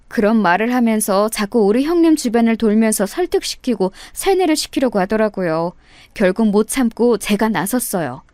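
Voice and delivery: ordinary delivery, nothing marked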